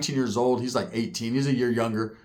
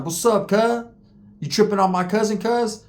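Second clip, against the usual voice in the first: confrontational gang voice; 6 semitones higher than usual. Retold or imitated. Crip cousin confronting.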